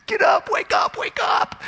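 urgent high shout; frantic acted wake-up call; yelling captain. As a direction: bellow to rouse a sleeper, rapid repeats. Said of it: "the captain's yelling at him".

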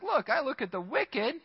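indignant psalmist asking how the wicked get ahead; exasperated psalmist. Retold or imitated. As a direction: voice climbing in disbelief. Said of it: "This guy's like".

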